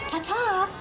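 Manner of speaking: usual speaking voice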